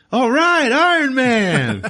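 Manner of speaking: high, loud whooping onlooker; excited cheering. Retold or imitated. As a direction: cheer wildly at a passing hero, very high and loud. Same guy cheering Iron Man.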